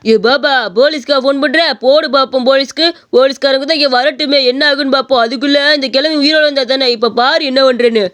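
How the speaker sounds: put-on rough drunk picking a fight, rude and taunting; drunk bully. Drunk man demanding meat from the old shopkeeper, taunting. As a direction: slur out insults at the old man. Drunk mocks police threat.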